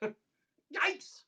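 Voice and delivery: whispered high "Yikes"; tiny whispered yelp as a closing gag; high, voiceless mock cry; mock yell. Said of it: "And he yells".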